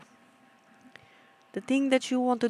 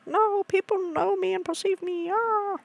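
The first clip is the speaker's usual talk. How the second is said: much higher frantic inner voice; hammed-up high panic; mock panic; 12 semitones higher than usual. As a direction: squeal it in panic. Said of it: "I was like".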